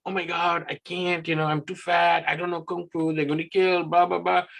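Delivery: a mock freak-out, high and rushed; freaking out panda. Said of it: "he's freaking out and saying".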